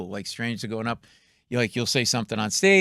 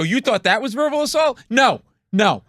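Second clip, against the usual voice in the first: mock verbal assault; 4 semitones higher, 5 dB louder than usual. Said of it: "I go".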